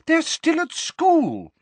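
very high, loud, drawn-out objection; shrill motherly protest; outraged protest. A narrator's reading. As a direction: wail the objection at your husband.

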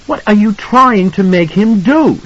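high-pitched, drawn-out demand; angry wife. A narrator's reading. Mother confronts husband over their son, angry and high. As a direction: confront him, voice pitched right up. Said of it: "her face pale and angry".

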